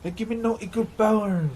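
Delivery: high put-on character voice; movie quote voice. Barber quoting the film line again in a high character voice.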